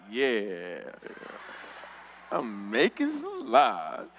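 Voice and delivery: slow, low, stretched-out confirmation; smug mock piety. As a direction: agree slowly and low from mid-prayer. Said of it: "I'd be like".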